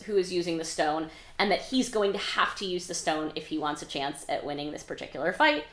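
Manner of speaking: usual speaking voice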